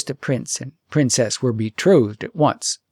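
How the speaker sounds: plain reading voice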